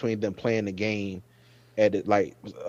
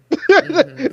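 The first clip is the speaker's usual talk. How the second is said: laughing squeal; high squeal of a laugh; 7 dB louder than usual. Real, not acted. Fan laughing at a funny line while retelling it.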